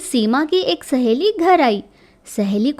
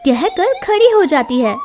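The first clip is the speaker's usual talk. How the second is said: ordinary delivery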